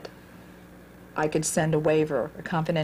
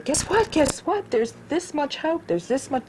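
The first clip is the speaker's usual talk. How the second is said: much higher, bursting with the news; excited news; 10 semitones higher than usual. Retold or imitated. Calling everyone all day about the reunion, excited. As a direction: squeal it out like you can't hold it in.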